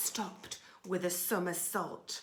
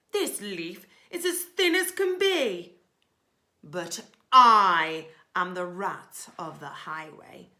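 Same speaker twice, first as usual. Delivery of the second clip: loud, scornful highway rat; loud, sneering robber rat; ordering rat; 12 dB louder than usual. Acted. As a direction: bark the orders like a bully rat.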